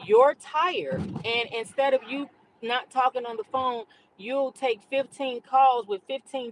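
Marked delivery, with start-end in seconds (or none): 0.1-3.4 firm mom. Retold or imitated. Scolding son in reply.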